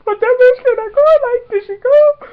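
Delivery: high, loud whimpering wail; hammed-up tearful questioning; mock crying. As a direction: wail like an abandoned fan. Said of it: "We're crying".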